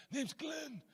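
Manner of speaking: very soft, high mobster rasp, Godfather-style; Glenn. Acted. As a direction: imitate an intimidating mob guy under the breath. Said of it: "Literally like this".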